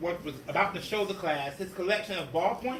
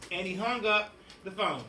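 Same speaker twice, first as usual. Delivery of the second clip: plain reading voice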